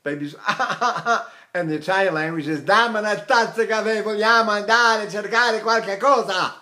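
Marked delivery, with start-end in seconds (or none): 0.5-1.3 short repeated "ah" wails; baby cry. Shown as a demonstration. Teacher likens Italian to crying, short baby wails. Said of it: "like a baby's cry". 2.6-6.5 continuous open-voiced Italian chatter; spoken Italian. Shown as a demonstration. Teacher shows how Italians talk, ringing cry-like Italian. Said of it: "And they talk like that".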